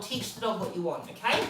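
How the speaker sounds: ordinary talk, nothing marked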